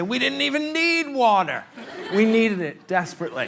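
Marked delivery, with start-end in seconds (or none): 0.0-1.6 put-on old man comedian. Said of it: "old man comedian who's gonna say".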